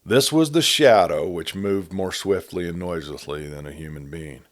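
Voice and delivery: ordinary delivery, nothing marked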